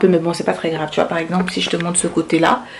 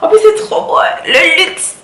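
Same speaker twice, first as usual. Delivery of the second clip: excited; 11 semitones higher, 5 dB louder than usual. Real, not acted. Admiring the gift.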